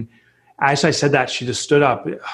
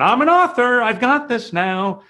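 mock triumphant; 11 semitones higher than usual. Mocking his own naive certainty to the host, hammed-up triumphant boast. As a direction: ham up the self-satisfied announcement. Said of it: "woke up and said".

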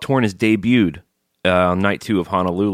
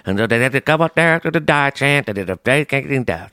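hillbilly voice; 7 semitones higher than usual. Pretend. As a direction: play a backwoods old-timer, pitched up. Hillbilly voice bit.

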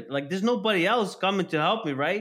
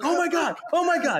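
high-pitched panicked scream, hammed up; mock scream; 12 semitones higher than usual. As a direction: shriek it like a terrified passenger. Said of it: "on top of his lungs".

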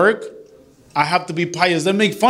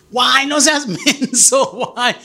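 mock scolding wife; 7 semitones higher, slower than usual. Wife scolding him, laughing.

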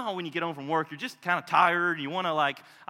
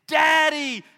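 put-on higher and louder, excited children calling Daddy; kids shouting; 10 semitones higher, 11 dB louder, slower than usual. Preacher voicing his children yelling for their father at the door, high excited shout.

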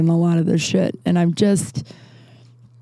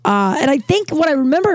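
ordinary delivery

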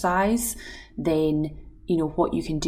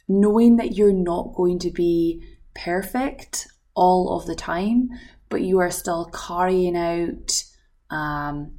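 ordinary talk, nothing marked

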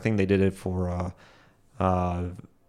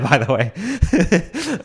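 laughing; 9 semitones higher, 3 dB louder, much slower than usual. Real, not acted.

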